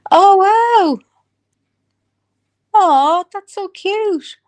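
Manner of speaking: very high drawn-out squeal of delight; delighted cooing. Real, not acted. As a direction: coo over something adorable, pitched right up.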